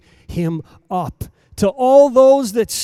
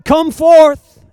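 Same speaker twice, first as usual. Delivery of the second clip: higher, louder ringing call; loud cry; 9 semitones higher, 13 dB louder than usual. Acted. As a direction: call the dead out of the tomb. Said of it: "You've cried out".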